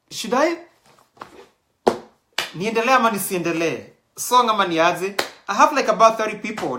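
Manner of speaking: excitedly asking the audience, racing ahead. Real, not acted. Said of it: "excited host, fast".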